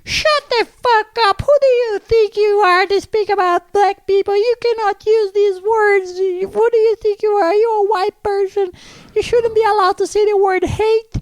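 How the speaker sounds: high, loud, slow mock tirade of an outraged woman; hammed-up furious lady shouting down the comic; imitating an angry woman.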